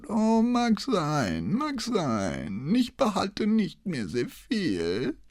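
very slow, high, wavering sing-song; doddering old man. A narrator's reading. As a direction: drag it out like a forgetful greybeard. Old man plays feeble forgetfulness.

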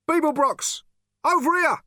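very high, urgent call; shouting. A narrator's reading. Stranger calling Zaphod down a corridor under bombing, urgent shout. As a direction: holler to get someone's attention. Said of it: "he shouted".

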